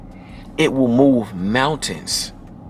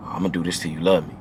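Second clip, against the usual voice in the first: low, soft murmur; low in her ear; 8 semitones lower, 8 dB quieter than usual. Retold or imitated. Whispering into a lover's ear, low seductive murmur. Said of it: "in her ear".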